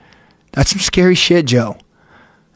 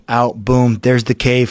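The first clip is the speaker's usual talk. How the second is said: usual speaking voice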